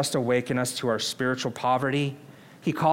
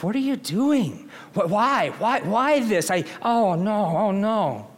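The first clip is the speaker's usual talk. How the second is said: anguished plea; 5 semitones higher than usual. Retold or imitated. Voicing anguish over loved one.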